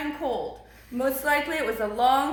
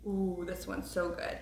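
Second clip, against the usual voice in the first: usual speaking voice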